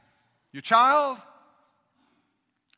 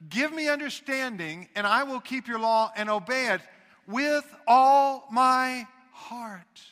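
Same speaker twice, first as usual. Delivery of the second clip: slow and loud, stretching out "with all my heart"; emphatic verse reading; 4 semitones higher, 7 dB louder, slower than usual. Shown as a demonstration. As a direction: hammer each word of "with all my heart". Re-reads verse, stressing its key phrase.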